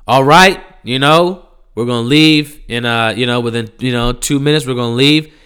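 a lightly voiced bored driver announcing; bus driver.

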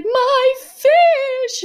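high, loud mock wail of a heartbroken kid; imitated child's wail. Parent mimicking daughter over dead betta fish, mock wail. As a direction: wail it like a devastated little girl.

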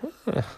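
high sly giggle; rabbit's high little mocking laugh. A narrator's reading. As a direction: snicker at him. Rabbit laughs at trapped fox.

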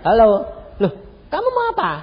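high, loud surprised hello; surprised greeting. Retold or imitated. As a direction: greet him with a lifted, bright surprise. Duta besar menyapa dengan ramah. (sapaan kaget bernada tinggi)

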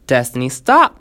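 loud, short command to stop; barked order. A narrator's reading. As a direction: bark it out to cut someone off. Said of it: "Nick barked".

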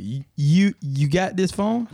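high, slow mock Baltimore accent. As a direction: imitate a Baltimore customer, drawn out. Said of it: "was from Baltimore".

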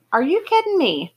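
high-pitched, disbelieving exclamation; incredulous question. A narrator's reading. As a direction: ask it in stunned disbelief. Jerry disbelieves the murder claim.

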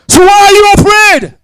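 ringing preacher's cry; preaching shout. Acted. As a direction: demand an answer from the congregation. Preacher's challenge, shout heard.

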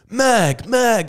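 high, loud yelps of mag; mock action hero calling for ammo; hammed-up panicked yell; mock frantic shout. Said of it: "he's like".

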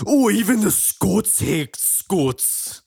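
high, drawn-out cartoon Scottish accent; Willie. Cartoon groundskeeper grumbling about Scots, hammed-up Scottish. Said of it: "That's what he sounds like".

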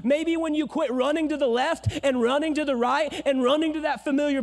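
loud, high fiery sermon; full-force preaching. Real, not acted. Pastor rousing the congregation, fiery and loud.

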